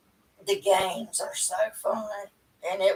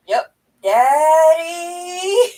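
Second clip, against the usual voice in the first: very slow, higher, louder hollered call; mock hollering; 7 semitones higher, 7 dB louder, much slower than usual. Wife imitating husband hollering back from the living room, slow loud call. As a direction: holler it drawn out across the house. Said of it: "he would have been hollering".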